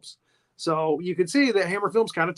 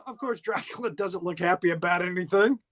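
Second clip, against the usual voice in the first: normal talking voice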